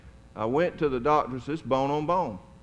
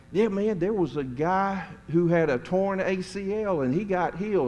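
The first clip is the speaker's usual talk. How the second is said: higher, eager storyteller voice; excited greeter; 6 semitones higher than usual. Retold or imitated. Greeter sharing a healing story, raised excited voice. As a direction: rush it out like thrilled news. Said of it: "he was like".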